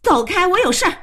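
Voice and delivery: sharp high-pitched outburst; angry command. Acted. Woman to man blocking her, angry command. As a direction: order him out of the way, urgently.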